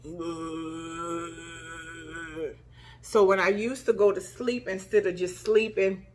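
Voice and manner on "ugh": quiet throat rattle. Shown as a demonstration. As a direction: demonstrate a death rattle. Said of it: "this is what it sound like".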